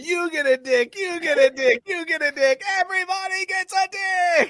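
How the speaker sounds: rising giveaway shout; mock Oprah. Imitating Oprah's giveaway, building to a high final line. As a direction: build like a talk-show host giving away cars. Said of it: "it's like Oprah".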